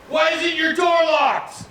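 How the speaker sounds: very high, slow shouted demand; mock cop shout. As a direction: bark it like an angry armed cop. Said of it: "the one cop goes".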